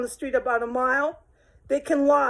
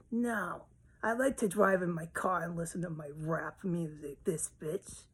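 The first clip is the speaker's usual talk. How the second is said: smug sadist; 8 dB quieter than usual. Acted. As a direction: relish her misery under your breath. Sadist voiced again, gloating.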